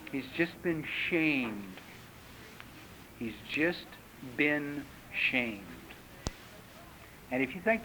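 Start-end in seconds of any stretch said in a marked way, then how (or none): 0.1-5.6 much softer, slow and low; hushed gravity. Real, not acted. Speaker telling audience the child was shamed, hushed gravity. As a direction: let each word land quietly. Somber punchline after toddler story.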